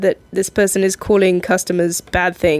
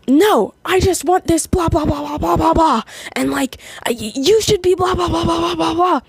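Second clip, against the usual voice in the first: higher, nagging customer voice; a customer whining at the counter, mock-nagging; whiny complaining customer; 7 semitones higher than usual. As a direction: mimic a customer going on and on.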